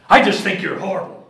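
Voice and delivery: loud indignant rebuke; indignant pastor's wife. Retold or imitated. Pastor's wife scolding the preacher, loud indignation. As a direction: scold him primly.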